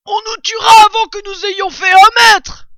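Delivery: exclamation très aiguë, à pleine voix; cri alarmé. A narrator's reading. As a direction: crie le danger aux autres. Blaireau s'alarme du danger dehors. (blaireau qui s'alarme en hurlant)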